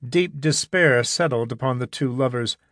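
usual speaking voice

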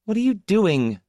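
put-on high, indignant child's question; stern little girl. A narrator's reading. Small girl catching the maid at her closet, stern. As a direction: demand an answer in a small girl's high voice. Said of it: "Zida asked sternly".

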